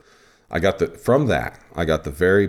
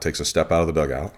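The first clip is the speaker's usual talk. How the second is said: normal talking voice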